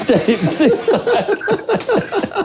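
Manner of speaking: high, slow, through laughter; laughing. Real, not acted. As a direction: squeal it out laughing. Reacting to outrageous answer. (en riant aux éclats)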